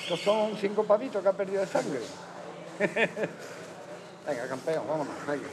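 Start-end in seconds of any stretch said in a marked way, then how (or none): 2.1-4.2 chuckle. Real, not acted. Coach joking about a little blood, soft chuckle. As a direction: laugh softly at your own joke.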